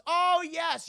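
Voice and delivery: a put-on moment of recognizing someone; mock recognition. Triad boss recognizing the funny little friend, mock delight.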